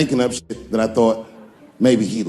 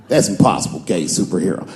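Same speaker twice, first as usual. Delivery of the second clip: lower, gruff objection; mock Texan grumble; 7 semitones lower than usual.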